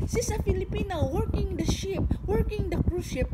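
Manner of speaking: high, excited call over the engine; shouted from motorbike. Retold or imitated. Driver shouts to neighbours from the moving motorbike, high excited call. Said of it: "sinisigaw niya".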